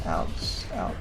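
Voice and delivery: ordinary talk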